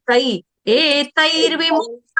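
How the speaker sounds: एतैर्विमुक्तः slowed, the ai of तै drawn out; slow एतैर्विमुक्तः with a clear ai; pronunciation demo. Shown as a demonstration. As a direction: stretch तै in एतैर्विमुक्तः. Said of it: "एतैर् भी नहीं है".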